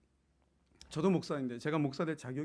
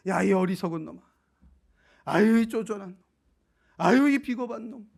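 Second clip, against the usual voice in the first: louder, scornful name-calling; mock scolding; 7 semitones higher, 11 dB louder than usual. Imagined God scolding Gideon, in loud mock scorn. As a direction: sneer out the insults like a harsh master. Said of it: "이렇게 부르신 게 아니고".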